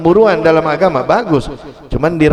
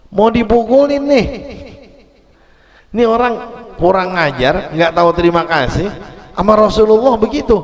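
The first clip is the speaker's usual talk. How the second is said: angry companions; 6 semitones higher than usual. Acted. Preacher acting the companions angry at the Bedouin. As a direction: fume at the rude man, half laughing.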